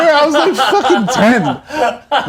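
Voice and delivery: real laughter while telling a sore memory; laughing. Real, not acted. Laughing to a friend about being beaten as a kid. Laughing at his own story.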